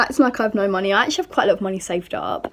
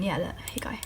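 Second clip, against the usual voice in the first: soft whispered aside; hushed and soft; 4 semitones lower, 11 dB quieter, faster than usual. Real, not acted. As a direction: whisper it to yourself.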